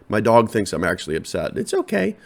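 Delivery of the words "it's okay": high-pitched soothing aside; tiny high voice, as to a pet; reassuring the dog. Real, not acted. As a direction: comfort a worried dog in a high coo. Soothing his worried dog.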